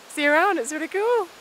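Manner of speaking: shouting over wind. Real, not acted. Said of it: "I hope you can hear me over the wind".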